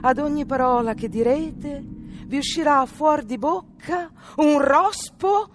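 fairy's voice. A narrator's reading. Fairy pronouncing curse.

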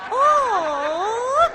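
drawn-out high waking moan; theatrical moan. Acted. Countess waking from her faint, with a drawn-out moan. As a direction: come to with a comic groan.

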